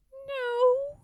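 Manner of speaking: high tiny peep. Acted. Frog answers the boasting Toad, tiny high peep. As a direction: squeak it out small. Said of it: "peeped Frog".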